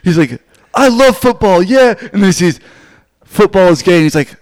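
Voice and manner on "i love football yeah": high, loud gush of enthusiasm; eager kid. Acted. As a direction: play an excited high school kid. Acting eager high school kid.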